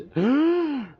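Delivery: loud, high squealed gasp; high drawn-out gasp. Real, not acted. Streamer spots the shiny mid-hunt, shocked high gasp. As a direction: suck in a long, shocked squeal.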